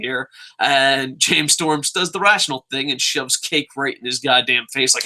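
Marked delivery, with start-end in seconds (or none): none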